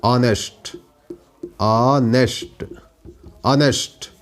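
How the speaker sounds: ऑनेस्ट repeated slowly with no h sound; silent h. Shown as a demonstration. Teacher repeating the word for students, silent h. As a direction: drill ऑनेस्ट, vowel first, no h. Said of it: "ऑनेस्ट होता है".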